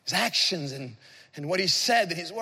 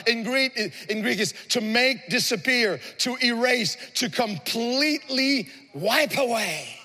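impassioned preaching; 6 semitones higher than usual. Real, not acted. Preacher urging the congregation to thank God for forgiveness, impassioned. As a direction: preach it out with full force.

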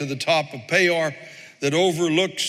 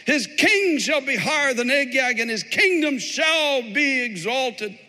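raised, declaimed prophetic blessing; exultant preaching; 7 semitones higher than usual. Acted. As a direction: proclaim the prophecy in a lifted pulpit voice.

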